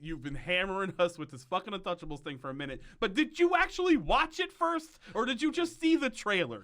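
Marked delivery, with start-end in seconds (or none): 3.0-6.6 much higher and a bit louder, scolding the writers; exasperated challenge. Real, not acted. Host challenging the writers over the trailer, exasperated and high. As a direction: demand an answer, fed up. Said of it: "it made me so mad when he said it".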